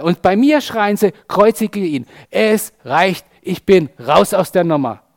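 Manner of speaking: hammed-up aggrieved outburst, hammering the key words; Jesus, mock fed-up rant. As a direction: rant like someone quitting in outrage. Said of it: "Können wir uns Jesus nur im Entferntesten so vorstellen?".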